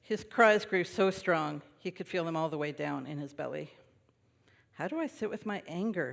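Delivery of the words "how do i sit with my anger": wondering quietly. Acted. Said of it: "he wondered".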